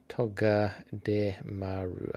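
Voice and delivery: Togedemaru slowly, syllable by syllable; sounding it out. Shown as a demonstration. Streamer working out how to spell it, sounding it out slowly. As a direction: break Togedemaru into slow, separate syllables. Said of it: "that's how you spell it".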